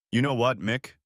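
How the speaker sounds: plain reading voice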